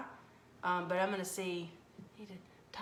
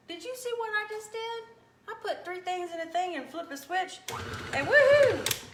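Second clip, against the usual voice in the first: high, bright cheer ending in a loud woohoo; excited; 10 semitones higher, 8 dB louder than usual. Real, not acted. Cook cheering viewers on over the mixer, excited. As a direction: celebrate how easy it is.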